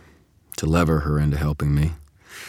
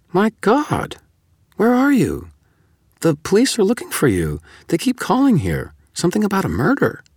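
much higher, louder, rushed alarm; 5 dB louder than usual. A narrator's reading. Susan warning Harry the police want him, alarmed. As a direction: blurt it out in shock.

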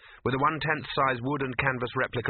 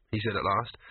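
ordinary delivery, nothing marked

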